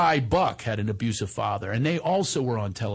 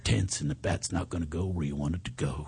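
calm coaching voice; 8 semitones lower than usual. Retold or imitated. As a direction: talk him down gently like a calm cowboy dad. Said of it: "I didn't do it with an accent".

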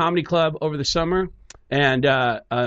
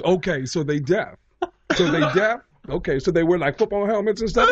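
Tracy Morgan; 3 semitones higher than usual. Retold or imitated. Comedian trying to understand deafness, oblivious questioning voice. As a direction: puzzle it through with dim sincerity. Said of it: "And he goes".

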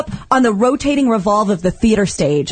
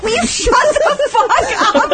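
a much higher, slower, laughing put-on of an annoyed yell; mock exasperated boyfriend; 12 semitones higher, slower than usual. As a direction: hammer out the annoyed boyfriend, cracking up. Boyfriend yelling at her.

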